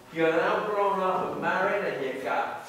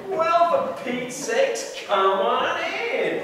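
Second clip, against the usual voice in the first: delighted greeting; 7 semitones higher, 6 dB louder, slower than usual. Acted. Greeting old flame at door.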